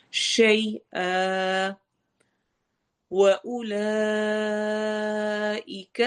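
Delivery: drawn-out tajweed chant; slow Quran recitation. Shown as a demonstration. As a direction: hold the madd at full length. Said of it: "مد کو proper لمبا کرنا ہے". (لمبی مد کے ساتھ تلاوت)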